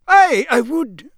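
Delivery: slow, high-pitched local character; drawn-out high reply, in character; Mister Roberts. Acted. Campsite Muggle confirming his name, high and slow.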